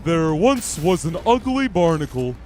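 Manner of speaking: loud, slow, dopey cartoon starfish; Patrick voice. Starfish telling a scary campfire tale, in slow loud Patrick voice. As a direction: drag it out like a dim-witted starfish. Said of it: "I can probably do a better Patrick".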